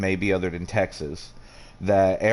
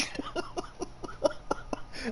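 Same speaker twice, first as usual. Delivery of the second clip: breathless high wheeze; silent wheezing laugh; 13 dB quieter than usual. Real, not acted. Telling a friend about the yo mama phone, wheezing laugh. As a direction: laugh without voice.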